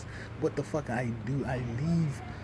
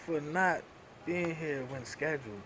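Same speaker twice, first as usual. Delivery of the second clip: ordinary delivery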